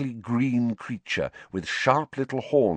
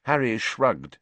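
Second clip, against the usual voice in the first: ordinary delivery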